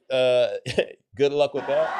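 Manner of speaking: laughing, skeptical comeback; laughing retort. Retold or imitated. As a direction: scoff it off with a laugh. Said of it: "I said".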